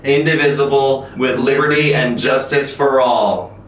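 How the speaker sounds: slow, measured schoolroom recitation; Pledge of Allegiance recital. Shown as a demonstration. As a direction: recite it like every school morning. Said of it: "That is the Pledge of Allegiance".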